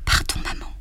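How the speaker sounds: breathy whispered plea, almost inaudible; silent whisper. A narrator's reading. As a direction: whisper it as an inner cry. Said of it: "Cette exclamation silencieuse". (chuchotement silencieux)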